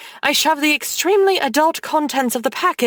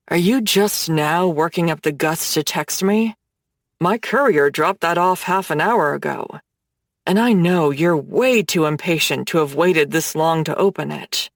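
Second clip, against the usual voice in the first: Hades, low voice; 6 semitones lower than usual. Acted. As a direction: tease her, low and confident like a dark god.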